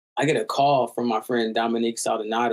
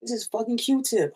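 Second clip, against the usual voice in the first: high astonished line; stunned self-quote; 10 semitones higher than usual. Retold or imitated. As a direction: let the disbelief lift it. Retelling his stunned thought.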